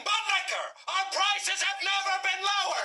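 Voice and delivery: yelling at the client. Acted. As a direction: bellow every word at the deaf client. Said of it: "You never yell at the client".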